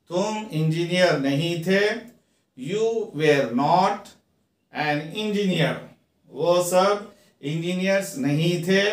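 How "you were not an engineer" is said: slow, each word apart; slow teaching read. Shown as a demonstration. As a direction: dictate it slowly to students. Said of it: "teacher reads model sentence".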